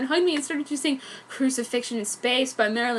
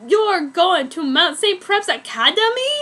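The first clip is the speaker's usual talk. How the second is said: louder, higher outraged question; yelling; 5 semitones higher, 6 dB louder than usual. Acted. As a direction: shout in disbelief. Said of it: "Edward yelled".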